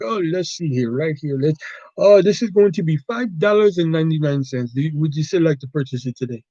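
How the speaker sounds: put-on Indian-accented cashier; caricatured Indian accent. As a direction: ring up the sale in a comic Indian accent. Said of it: "generic Indian character".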